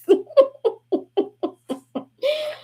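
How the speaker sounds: shrieking laugh; high-pitched cackling laugh. Real, not acted. Big laugh.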